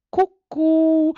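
very high squeaky teasing cucù; mocking cuckoo. A narrator's reading. Pinocchio goading the boys, a very high mocking cucù. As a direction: sing it right up high to goad them. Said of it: "in segno di canzonatura".

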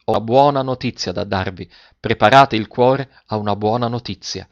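ordinary delivery

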